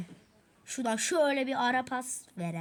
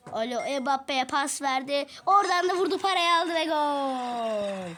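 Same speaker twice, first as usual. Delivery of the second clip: excited football commentator; 4 semitones higher, 7 dB louder than usual. Acted. Child commentating own game goal.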